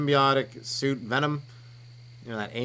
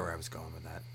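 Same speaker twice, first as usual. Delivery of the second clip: unvoiced trailing whisper; 6 semitones lower, 13 dB quieter than usual. Real, not acted. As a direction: lose the thread in a whisper. Trails off, lost thread.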